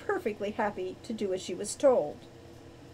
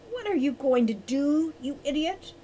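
higher-pitched show of temper; snapped; 5 semitones higher, 3 dB louder than usual. A narrator's reading. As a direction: snap at someone in exasperation. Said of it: "snapped Owl in a rare display of temper".

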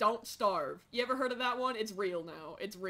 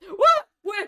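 very high, loud what; piercing startled what; shocked shriek; 10 semitones higher, 15 dB louder than usual. Real, not acted. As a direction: shriek in alarm. Alarmed shock.

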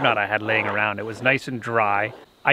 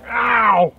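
pained yelp; 9 semitones higher, 7 dB louder than usual. Real, not acted. Hurt by oven heat.

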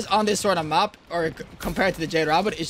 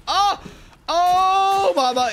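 excited exclamation; 12 semitones higher, 4 dB louder, much slower than usual. Real, not acted. Sees a big kill.

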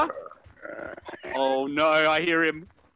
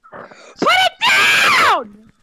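screaming; 10 dB louder, much slower than usual. Acted. Screaming at the knife-wielding narrator.

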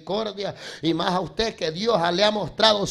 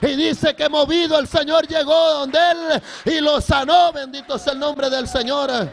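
high, loud sermon climax; full-force preaching; 7 semitones higher, 6 dB louder than usual. Acted. Preacher telling of the healing, full-force preaching. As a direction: proclaim it at full voice.

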